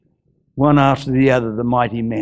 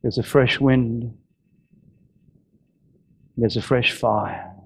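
prayerful close. Acted. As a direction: draw the church into prayer gently.

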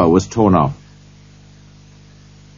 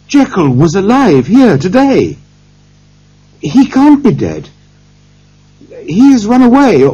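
high, urgent, raised voice; excited cry; 8 semitones higher, 5 dB louder than usual. A narrator's reading. Lawyer realises Jekyll may be alive and warns Poole, crying out. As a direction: exclaim it urgently, pitch well up. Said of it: "he cried".

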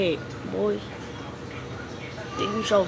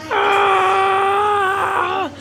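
high loud startled cry; pained yelp; 8 semitones higher, 4 dB louder than usual. Real, not acted. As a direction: yelp as the hit lands. Character getting hit.

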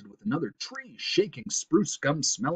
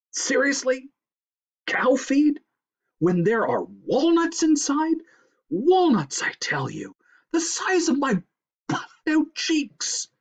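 high, loud, incredulous squeal; scornful outburst; 5 dB louder than usual. A narrator's reading. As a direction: pitch it up and punch each craved word. Character scorns cow feed, craves walnuts.

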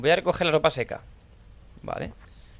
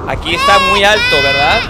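loud; 4 semitones higher, 7 dB louder than usual. Real, not acted. Reacting to own loud call.

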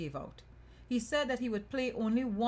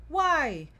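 loud, high cry of "why"; sudden cry; 7 semitones higher, 9 dB louder than usual. A narrator's reading. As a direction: burst out with a sudden high call. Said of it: "he would suddenly cry out".

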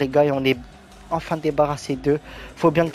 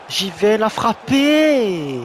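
excited shout; 8 semitones higher, 7 dB louder, much slower than usual. Real, not acted. Shooting in football game.

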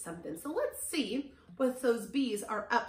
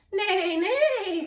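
high, loud whinnying neighs; big playful horse call; 9 semitones higher, 8 dB louder than usual. A narrator's reading. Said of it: "says Horse".

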